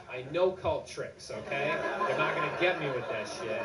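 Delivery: mock confident boast; insistent. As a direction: lean into it like you can't be fooled. Insisting to friend.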